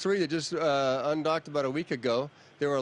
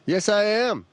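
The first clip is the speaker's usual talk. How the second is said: loud reply over the radio link; radio transmission; 6 semitones higher, 9 dB louder, slower than usual. Real, not acted. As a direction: answer over a radio channel. Answer over the space radio link.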